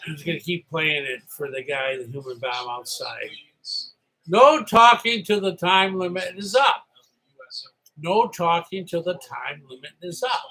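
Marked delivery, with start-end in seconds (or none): 4.3-6.9 called out loud and firm; loud firm rule call; loud announcement. Retold or imitated. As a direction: bark the rule at the whole room. Clark Kent quote, announced rule.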